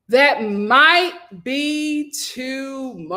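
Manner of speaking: very slow "That might be tomorrow"; slow drawn-out. Real, not acted. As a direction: stretch it out, resigned.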